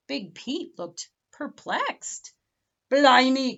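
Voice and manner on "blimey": pirate exclaim. Acted. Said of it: "cried Big Pete".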